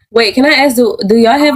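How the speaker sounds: ordinary talk, nothing marked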